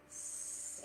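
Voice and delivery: long breathy sss on the exhale; snake hiss. Shown as a demonstration. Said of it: "exhale like a snake".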